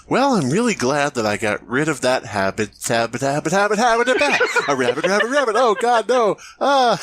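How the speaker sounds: hammed-up loss of control, pitch climbing sharply; mock relapse. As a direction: start composed, then spiral up into high-pitched panic. Acting Chelsea relapsing into magic chant.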